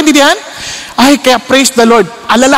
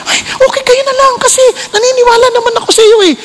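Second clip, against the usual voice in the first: much higher, lightly voiced trusting mother; mother's voice; 13 semitones higher than usual. Girlfriend's mother declining the movie invite, higher voiced. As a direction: play the easygoing mom brushing it off.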